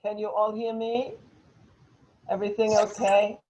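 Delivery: ordinary talk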